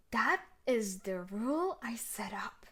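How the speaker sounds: normal talking voice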